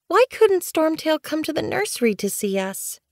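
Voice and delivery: put-on high-pitched childish whine; whining kitten. A narrator's reading. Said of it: "Snow Kit whined".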